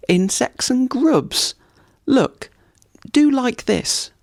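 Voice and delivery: giddy woodpecker; gleeful woodpecker. Acted. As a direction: show off to the deer, squeaky and thrilled. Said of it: "with a laugh".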